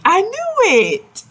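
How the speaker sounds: high, drawn-out delighted cry; excited exclamation. Real, not acted. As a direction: burst out as if you guessed right. Reader exclaims at story picture.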